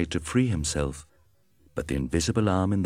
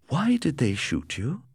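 higher, curious question; Kemp asking; 8 semitones higher than usual. A narrator's reading. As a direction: ask it with concern. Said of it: "Kemp asked".

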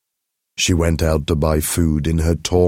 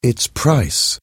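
high lady voice, gently breaking news; a lady breaking hard news, slowing at the end; lightly voiced lady; 7 semitones higher, slower than usual. Telling Mathilde the necklace was fake, high and gentle.

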